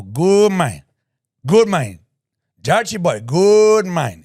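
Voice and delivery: high, loud imitation of an enthusiastic barber; hammed-up barber greeting; barber's praise. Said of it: "is what he says to me".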